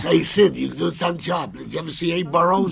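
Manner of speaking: lightly voiced president; Roosevelt impression. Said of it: "like Abe Burrows".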